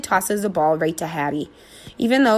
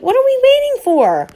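impatient eager dog; 7 dB louder than usual. A narrator's reading.

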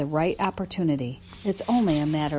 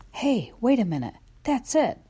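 excited realization; 6 semitones higher than usual. Acted. Dog gets sudden idea.

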